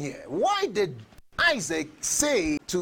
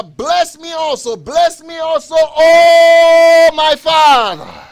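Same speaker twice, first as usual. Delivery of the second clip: higher, much louder pleading shout; drawn-out wailing shout of Esau begging; bitter cry; 5 semitones higher, 12 dB louder, slower than usual. Acted. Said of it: "cried with an exceeding and bitter cry".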